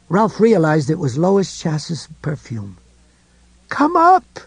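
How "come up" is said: high urgent scream. A narrator's reading. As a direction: shout it up at him. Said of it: "she screamed".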